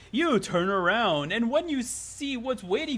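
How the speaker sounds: slow, drawn-out dramatic reveal; theatrical storyteller build-up; story narration. A narrator's reading. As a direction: narrate it with stretched emphasis. Reads game narration aloud.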